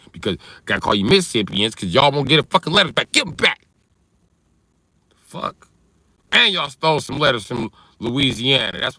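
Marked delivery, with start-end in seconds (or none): 3.1-3.7 mock demand. Repeated demand for letters.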